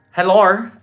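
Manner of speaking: one-word attempt at an Australian accent; hammed-up Australian accent; bad Australian accent. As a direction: try out a clumsy Australian greeting. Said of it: "That was a bad accent".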